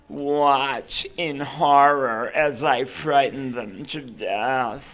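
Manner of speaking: slow, timid wishy-washy ghost voice; a frightened ghost trying to sound scary; scared little ghost. Acted. Ghost narrating his scare plan, slow and wishy-washy. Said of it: "wishy-washy little voice".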